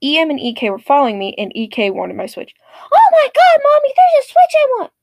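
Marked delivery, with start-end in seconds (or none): 2.9-4.9 put-on high loud childish squeal; greedy kid squeal. Entitled kid squeals to mom about the Switch, high loud squeal. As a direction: squeal like a spoiled kid spotting a toy.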